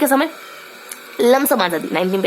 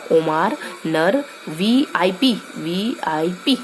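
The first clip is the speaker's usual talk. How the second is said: slow mnemonic; 3 semitones lower, much slower than usual. Shown as a demonstration. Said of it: "इसको ऐसे ध्यान रखेंगे".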